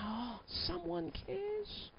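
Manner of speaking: slow, soft, touched sigh; Henry touched. Acted. Henry to his doctor, slow and softly grateful. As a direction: breathe it out, moved that someone listens. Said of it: "He's going".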